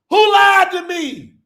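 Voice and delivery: slow, loud outraged demand; outraged shout. Retold or imitated. Believer realizing he was fooled, outraged shout. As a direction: yell it like you've just been betrayed.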